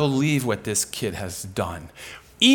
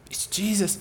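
soft whispered, higher awe; awed whisper; 6 semitones higher, 5 dB quieter than usual. Retold or imitated. Kid naming the light, in an awed whisper. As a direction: breathe the name in wonder.

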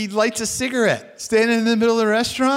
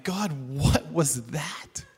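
bewildered; 4 semitones lower, 5 dB quieter, slower than usual. Retold or imitated. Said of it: "and so I'm like".

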